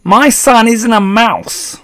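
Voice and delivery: high, loud, drawn-out protest; outraged shout. A narrator's reading. Father denying his son is a mouse, shouting. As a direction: bellow it slowly in disbelief. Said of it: "shouted Mister Jenkins".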